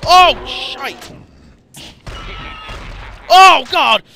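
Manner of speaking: loud, high shout of shock; startled gamer yell; alarmed cry. Real, not acted. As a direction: cry out as you get ambushed. Startled by enemy attack in game.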